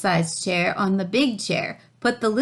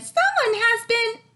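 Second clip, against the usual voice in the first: very high little cub voice; Baby Bear. A narrator's reading. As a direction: squeak it like a tiny cub. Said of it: "Baby Bear said".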